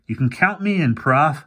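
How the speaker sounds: a hint of a spooked young boy; boy Fergie.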